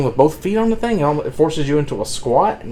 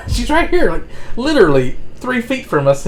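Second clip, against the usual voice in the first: high, pushy excited urging, much higher; urging friend on; 9 semitones higher than usual. Retold or imitated. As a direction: egg your buddy on, she's right there. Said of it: "I'm like".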